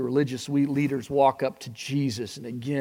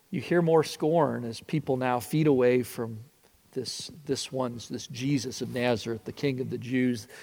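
normal talking voice

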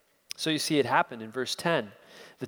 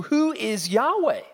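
high, scoffing sneer at the God he doesn't know; scornful Pharaoh; 7 semitones higher, 4 dB louder than usual. Acted. Preacher voicing Pharaoh's scorn, high sneering disdain. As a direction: sneer it out like a mocking king. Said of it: "it provokes Pharaoh with scorn".

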